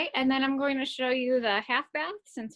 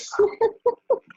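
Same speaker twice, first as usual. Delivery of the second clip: high, loud burst of laughter; embarrassed laughing burst; startled laugh; 13 semitones higher, 9 dB louder than usual. Real, not acted. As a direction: laugh out in shocked amusement. Shocked by "nice butt" remark.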